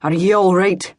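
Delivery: lightly voiced Scottish brogue, concerned; a hint of old Scots burr asking if she's hurt. A narrator's reading. Old Scotsman checks on the fallen woman, lightly voiced brogue. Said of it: "A gravelly brogue".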